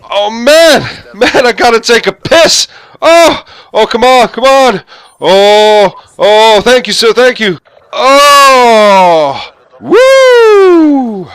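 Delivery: high, loud, frantic bathroom hollering; desperate loud yelling. Retold or imitated. Businessman running into the toilet, shouting desperately. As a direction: yell it like you're bursting for the toilet. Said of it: "at the top of his voice".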